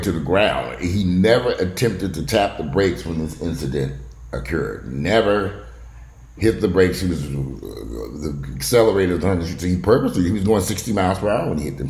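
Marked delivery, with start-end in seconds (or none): none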